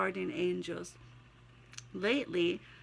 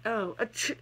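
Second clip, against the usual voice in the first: real sneeze; 4 semitones higher than usual. Real, not acted. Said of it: "it works too much".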